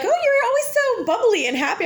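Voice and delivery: high, sing-song imitation of a stranger; lightly voiced gushing shopper; mock chirpy stranger. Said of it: "They're like".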